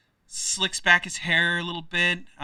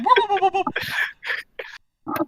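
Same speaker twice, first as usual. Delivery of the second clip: high-pitched whooping rewind sound; mock rewind whoops; rewind noise; much slower than usual. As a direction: imitate a tape rewinding in quick whoops.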